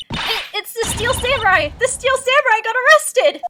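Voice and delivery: high, loud panicked blurt; frantic news. Acted. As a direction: gasp out the shocking news. Maya panicking on phone.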